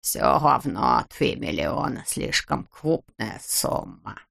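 low, slow grumble with F for R; grumbling. A narrator's reading. Miser haggles over the sum, low grumbling. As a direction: complain heavily, drawing words out. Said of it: "проворчал Скуперфильд".

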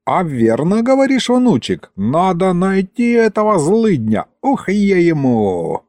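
put-on high, fired-up old man; feisty, eager grandfather; suddenly livened up. A narrator's reading. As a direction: perk up and threaten vengeance. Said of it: "вдруг оживился".

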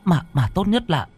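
high, hesitant sister's voice; younger sister, uneasy reassurance. Acted. Sister suggests they watch over mother, hesitant reassurance.

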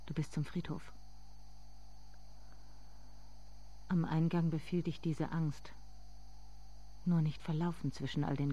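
soft, intimate narrating voice; softly spoken inner monologue; hushed story narration. A narrator's reading.

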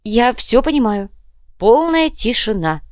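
slow, high, emphatic assurance; eager compliance. Acted. Captive promises silence to be freed, eagerly. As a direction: promise it with loud devotion.

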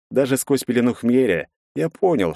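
ordinary delivery, nothing marked

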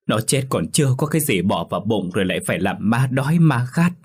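wailing lament. A narrator's reading. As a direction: wail and scold through tears. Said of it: "lại càng khóc lớn hơn".

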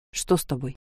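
low, soft worry; concerned. A narrator's reading. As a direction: ask gently, worried. Worried question.

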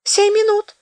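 high, hurried promise; hurrying. Acted. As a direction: rush it out anxiously. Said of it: "заторопился Федот".

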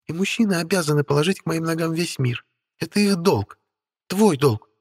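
high, light voice of a spoiled beauty; haughty young woman voice. Acted. Witch Stella speaking. (капризная молодая ведьма)

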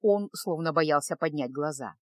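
usual speaking voice

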